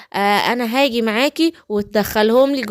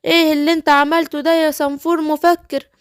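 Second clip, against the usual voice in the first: alarmed little Smurf, higher; 6 semitones higher, faster than usual. Pretend. Smurfs panicking after vanishing. (سنفور صغير مفزوع بصوت أعلى)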